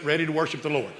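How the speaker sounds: normal talking voice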